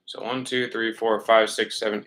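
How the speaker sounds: ordinary talk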